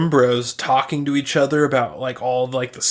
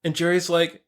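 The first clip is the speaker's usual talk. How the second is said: normal talking voice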